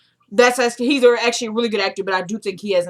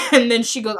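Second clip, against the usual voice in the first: ordinary delivery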